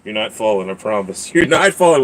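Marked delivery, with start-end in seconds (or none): 1.4-2.0 laughing. Real, not acted.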